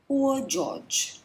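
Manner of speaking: usual speaking voice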